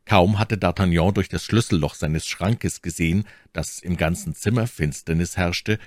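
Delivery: usual speaking voice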